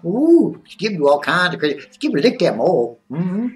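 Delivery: Skip. Pretend. Skip chatting to viewers about wrestling, put-on excitable character voice. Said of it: "So, so it's Skip now".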